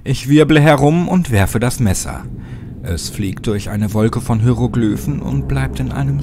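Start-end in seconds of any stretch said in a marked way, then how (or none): none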